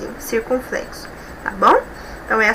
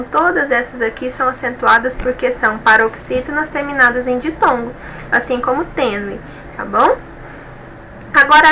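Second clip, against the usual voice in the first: ordinary talk, nothing marked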